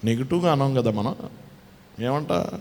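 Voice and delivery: normal talking voice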